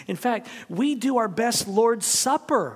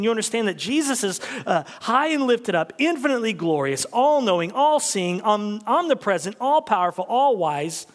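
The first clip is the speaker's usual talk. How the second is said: louder, higher, building list of praise; full-force preaching; 5 semitones higher, 5 dB louder than usual. Real, not acted. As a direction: preach it with mounting fire.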